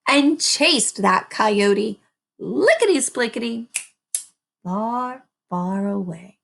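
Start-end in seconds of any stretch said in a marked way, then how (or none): none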